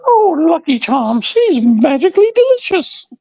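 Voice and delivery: squeaky high-pitched tease; sing-song high mock voice; high teasing voice. Teasing Lucky Charm in high voice.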